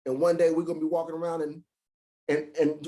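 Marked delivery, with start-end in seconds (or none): none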